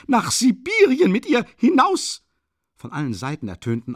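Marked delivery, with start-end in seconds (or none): 0.1-2.2 high, loud outraged cry; outraged shouting. A narrator's reading. Bystanders shout at Sonja, high and loud. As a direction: scream it in outrage. Outcries from all sides.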